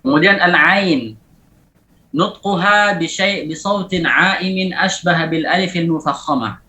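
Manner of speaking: normal talking voice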